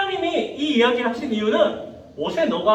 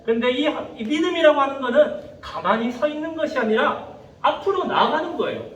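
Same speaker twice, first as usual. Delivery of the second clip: usual speaking voice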